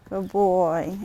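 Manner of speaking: low, soft murmur to the horse; soft, low praise for the horse. Real, not acted.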